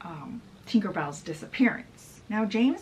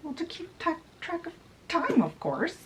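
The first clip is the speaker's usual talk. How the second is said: Lucinda voice; 9 semitones higher than usual. Acted. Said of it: "Lucinda's like".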